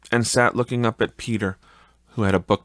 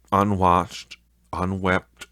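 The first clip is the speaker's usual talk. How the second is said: usual speaking voice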